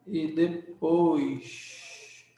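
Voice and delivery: very slow, stretched 'depois'; drawn-out word. Real, not acted. Teacher spelling out where the affix goes, very slowly. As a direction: draw out the word for the class.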